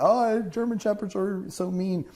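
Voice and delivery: high-pitched imitation of wary people; fearful people. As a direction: put on a high voice for a worried stranger. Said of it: "and people".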